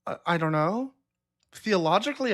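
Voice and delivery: ordinary talk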